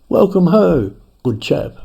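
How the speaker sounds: put-on a cheery British welcome, a touch posh in accent. Tibetan lama greeting surveyors in a perfect British accent. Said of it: "spoke with a perfect British accent".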